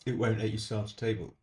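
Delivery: normal talking voice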